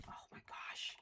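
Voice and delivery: exasperated whisper. Real, not acted. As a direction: breathe it under your breath. Frustrated with the game.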